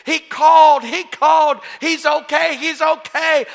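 high, loud, overjoyed cries; breathless-with-news mimicry, each phrase punched; imitated joyful screaming. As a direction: shout the good news like an ecstatic mother. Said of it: "She's screaming, shouting".

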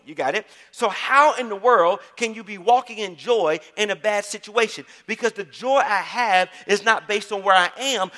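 emphatic sermon delivery, stretching the key phrases; fervent preaching. Real, not acted. Pastor declaring joy in faith to the congregation, fervent preaching. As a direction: preach it out with full conviction.

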